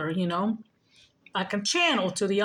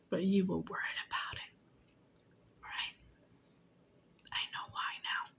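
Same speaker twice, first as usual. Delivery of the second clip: softer, hushed knowing whisper; whispered accusation; 10 dB quieter than usual. Real, not acted. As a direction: whisper it like a secret you caught.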